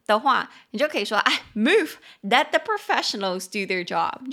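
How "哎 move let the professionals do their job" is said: teasing take-charge call; mock bossy shout. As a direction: push them out of the way, joking. Joking to friend at toilet.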